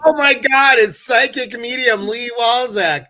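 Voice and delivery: delighted shriek for a surprise guest; thrilled. Real, not acted. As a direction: greet the arriving guest with a high, loud cry of delight. Thrilled to see guest arrive.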